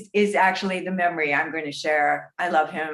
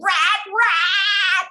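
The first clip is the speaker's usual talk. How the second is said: mock scream, much higher; imitated scream; 12 semitones higher, 4 dB louder than usual. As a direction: shriek it like a panicked actor. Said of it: "Lamar ran down stage screaming".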